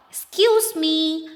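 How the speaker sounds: high, slow polite little voice; a teacher voicing the polite elephant child. A narrator's reading. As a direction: ask sweetly like a small child. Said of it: "asked the elephant child most politely".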